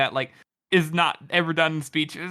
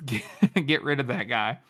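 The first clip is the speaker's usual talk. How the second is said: ordinary delivery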